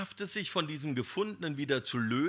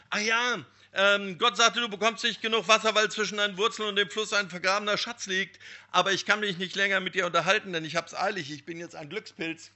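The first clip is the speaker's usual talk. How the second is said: higher, louder and fast, in a rush; hurried excitement; 8 semitones higher, 7 dB louder than usual. Acted. Young man hurries past the tree, rushing, loud and excited. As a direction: blurt it out, eager to get away.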